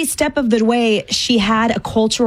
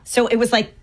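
ordinary talk

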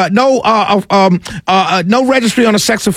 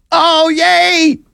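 high mock cheer, sarcastic; a fake little cheer for bad news; 6 semitones higher than usual.

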